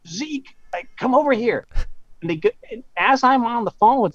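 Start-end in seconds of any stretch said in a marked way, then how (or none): 0.1-1.7 mock calling out, much higher pitch; high, held-back imitation of a yell; imitated yell. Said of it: "yell out, like".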